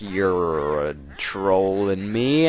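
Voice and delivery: slow, put-on witch voice; slow hammed-up Gruntilda sneer; mock witch voice. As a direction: drag it out as a sneering cartoon witch. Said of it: "And she's like".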